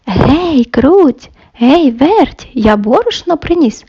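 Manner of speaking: plain reading voice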